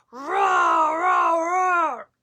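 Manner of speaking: loud roaring bear. Acted. Old Bear losing the game, loud roar. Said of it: "Old Bear roared".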